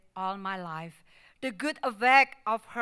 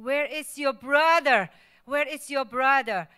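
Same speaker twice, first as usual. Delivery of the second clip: a father shouting for his lost son; frantic shout; 4 semitones higher, 9 dB louder than usual. Retold or imitated. Frantic dad searching for son.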